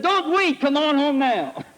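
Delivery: high, excited imitated husband; eager plea. Husband urges wife home by phone, imitated excitement. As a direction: build it high, drawing out the end.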